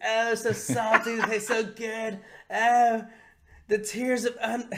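high, slow, savouring cartoon voice; Cartman. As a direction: relish every word in mock ecstasy. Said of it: "he starts licking his face like".

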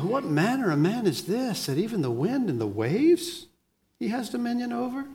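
wondering question, acted out in amazement; blown away. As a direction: imitate astonished disciples, pitch swooping up. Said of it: "they're all just blown away".

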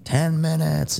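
high, drawn-out jump command; mock holler. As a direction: call it out slowly like a jumpmaster. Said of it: "I start t-, hollering them out".

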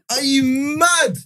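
drawn-out high are you mad; slow, high, outraged; slow indignant disbelief. Real, not acted. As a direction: stretch it out in disbelief. Indignant rhetorical question.

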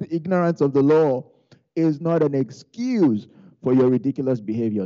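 hammed-up official telling-off; mock officer's lecture. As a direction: imitate a cop laying down the law. Officer lecturing the driver, acted.

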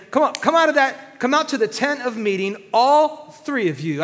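God summoning the quarrelling siblings, loudly; calling children in. Acted. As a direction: call them out like a fed-up parent. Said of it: "He's just calling his children who are fighting".

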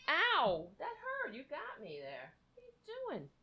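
quiet, jumpy yelps of mild pain; a real little flinch as the claws hit; startled ow at a scratch. Real, not acted. Cat just scratched him.

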